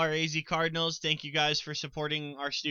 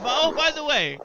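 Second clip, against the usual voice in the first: much higher, louder burst of excitement; sudden excitement; 12 semitones higher, 7 dB louder than usual. Real, not acted.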